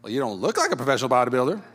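low, quick sceptical thought to yourself; mock a doubter thinking to themselves; dry aside, dropped low; doubting inner voice. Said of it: "You would start saying to yourself".

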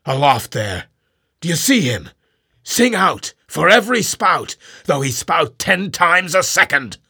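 high, loud shout up the mast; calling aloft. Acted. Ahab hails the lookouts aloft, loud shout. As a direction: hail the masthead across the wind.

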